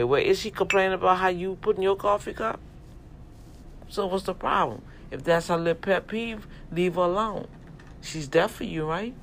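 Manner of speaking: loud, punchy street preaching; fired-up sermon to the men. Acted. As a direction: preach it hard to the brothers.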